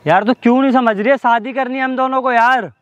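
high, exasperated pleading yell; real frustrated outburst, high-pitched; yelling in frustration. Real, not acted. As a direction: plead loudly, pitch shooting up. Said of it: "चिल्लाओ मत".

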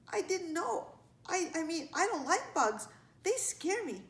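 high, hesitant excuse; sheepish child. A narrator's reading. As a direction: confess haltingly in a small child's voice. Scolded child admits fear.

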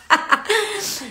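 high burst of real laughter; laughing. Real, not acted. Teacher amused by her own exaggerated example, laughing. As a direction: crack up at your own silly demo.

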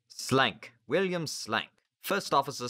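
high, loud self-announcement; officer introducing himself. A narrator's reading. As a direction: announce name and rank loudly.

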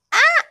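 high shrieking fan scream; excited scream. Real, not acted. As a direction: scream with delight. Fan screams opening the album.

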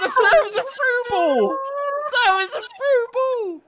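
high-pitched, disbelieving outcry; indignant gamer complaint; incredulous protest. Real, not acted. Protesting what the game did.

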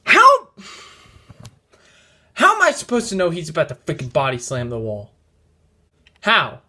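high furious yelling of how; exasperated gamer yell; outraged shout. Real, not acted. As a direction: bellow in disbelief at the crash. Outraged after wall crash.

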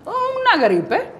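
high, drawn-out dismissal; slow and high, as if it were nothing; dismissive minimizing. Retold or imitated. Minimizing her cancer as a flu.

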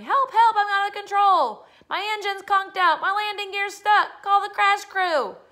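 high, loud mock-emergency holler; yelling for help. Boy racing downhill plays a crashing plane, yelling. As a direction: shout like a pilot going down, stretching the end.

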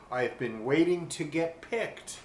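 ordinary delivery, nothing marked

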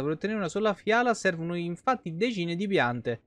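usual speaking voice

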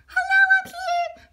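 high eager call from below; calling for attention. Acted. Arnie begs customers to notice him, high eager call.

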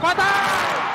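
high, loud excited exclamation; excited shout. Real, not acted. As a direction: yell it as the buzzer-beater drops. Last-second three-pointer goes in.

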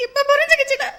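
mother breaking down before her son; sobbing. Acted. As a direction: break down weeping.

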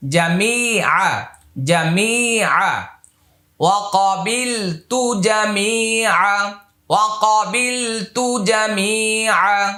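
slow, drawn-out জামিআ; slow model reading. Shown as a demonstration. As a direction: stretch the phrase for students to copy. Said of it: "teacher models phrase slowly".

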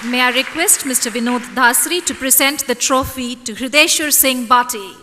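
ordinary talk, nothing marked

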